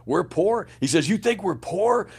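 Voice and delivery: imitating an outraged father who can't believe it; incredulous dad. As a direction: echo the word poor back in disbelief.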